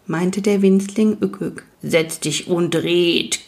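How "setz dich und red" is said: grind the words out slowly like rock; creaky rock-biter voice. Acted. Rock biter greets the newcomer by the fire, slow creaky rumble. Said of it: "knirschte Björn Rachzak".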